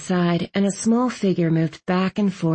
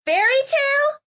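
put-on very high, puzzled girl's question; high baffled voice; confused. A narrator's reading. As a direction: echo it in confusion, pitched way up.

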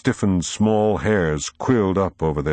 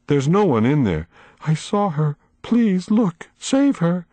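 a hint of a high, trembling old lady; lightly voiced old woman, tearful and pleading; shaken old woman; 10 semitones higher than usual. A narrator's reading. Miss Foley pleading.

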